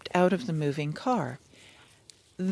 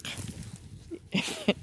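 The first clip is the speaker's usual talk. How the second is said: quiet laugh under the breath; a small whispered laugh; chuckling quietly in the bushes; soft breathy laugh; 7 dB quieter than usual. Real, not acted.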